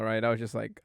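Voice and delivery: ordinary delivery, nothing marked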